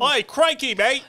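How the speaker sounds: high, loud mock Aussie yelp; hammed-up Australian accent.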